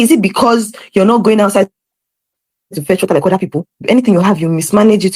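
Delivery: high, mock scolding dad; imitating a nagging father; dad's telling-off. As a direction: lecture her about wasting water. Dad scolds about wasted water.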